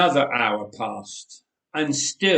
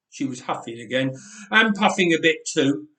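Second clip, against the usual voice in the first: usual speaking voice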